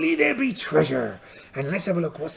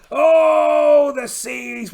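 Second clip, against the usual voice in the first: loud, high shouted "Oh" then falling "the seas"; mock pirate shout; 7 semitones higher, 8 dB louder, much slower than usual. Pirate captain crying out at the stormy seas, hammed-up shout. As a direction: bellow it to the heavens in jest.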